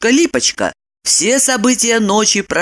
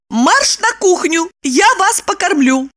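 commanding; 12 semitones higher than usual. A narrator's reading. Woman sends Roman to the kitchen, high commanding voice. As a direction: order him about, pitched high. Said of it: "скомандовала".

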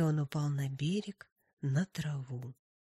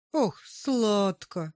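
slow, louder longing "ох"; dreamy sigh; 4 semitones higher, 6 dB louder, much slower than usual. Acted. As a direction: savour the sweetness. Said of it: "отвечал нарцисс".

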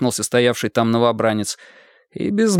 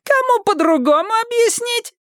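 much higher thin, whining voice; thin whiny voice. A narrator's reading. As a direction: squeal it, shrill and thin. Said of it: "зудящий тонкий голосок Мазура".

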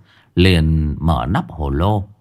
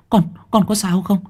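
much higher, near-shrieking worry; mother asking again, now in panic; panicked mother; 12 semitones higher than usual. Acted. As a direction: let the fear climb right up. Mother anxiously checks her son.

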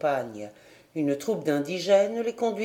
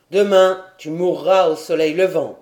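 chief's loud sentence; 2 semitones lower, 7 dB louder than usual. A narrator's reading.

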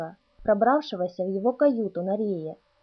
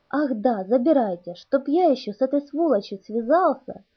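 exasperated outburst; 3 semitones higher than usual. Acted. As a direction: blurt it out in exasperation. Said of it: "в сердцах воскликнул капитан". (в сердцах, раздражённо)

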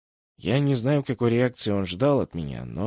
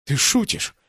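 horrified question; 6 semitones higher, 4 dB louder, slower than usual. A narrator's reading. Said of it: "с ужасом спросил он".

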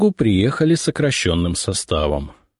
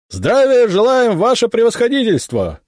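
unison shouted reply; 4 dB louder than usual. A narrator's reading. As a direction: bellow the reply as one company. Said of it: "Складно здороваются мои бойцы".

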